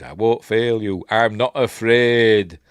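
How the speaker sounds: loud, slow, swelling vow; mock bravado. Luke vowing to Yoda, loud drawn-out mock bravery.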